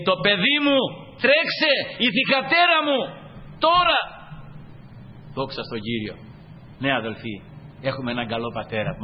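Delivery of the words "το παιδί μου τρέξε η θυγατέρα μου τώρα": urgent father. Retold or imitated. Father calls his daughter to run now, urgent shout. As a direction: call out urgently for the child. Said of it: "λέει".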